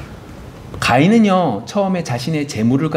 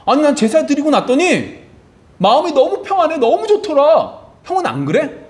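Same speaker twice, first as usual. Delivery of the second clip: much higher, happy younger brother voice; a brother glowing with peace after worship; joyful Abel; 12 semitones higher than usual. Acted. Abel answers Cain, acted dialogue.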